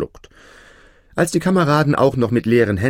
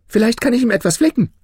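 high, quick, louder tailor voice; eager tailor; 5 dB louder, much faster than usual. A narrator's reading. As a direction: pipe up high and fast as the tailor. Erzählerin gibt dem Schneider eine Stimme. (hohe, schnelle Schneiderstimme)

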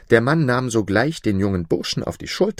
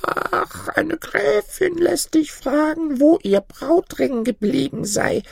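put-on very high, slow old woman voice; high-pitched, slow-talking little granny; old granny reporting; slower than usual. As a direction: draw it out in a tiny crone voice. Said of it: "antwortete das Mütterchen".

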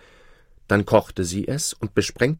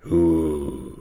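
slightly lower, drawn-out disguised voice; slow imitated voice; 3 semitones lower than usual. A narrator's reading. King imitating the slain man in the dark, slow disguised voice. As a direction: lightly put on another man's slow voice. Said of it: "bemühte sich dabei, die Stimme des Schwarzen nachzumachen".

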